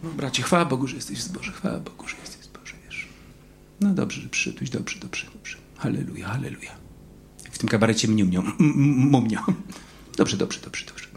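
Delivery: mock-sweet hushed whisper, soft and sly; sly tempter's voice. Preacher mimics the whispering voice flattering a believer, sly hushed whisper. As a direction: coax him like a sneaky flatterer. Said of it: "to był ten głos".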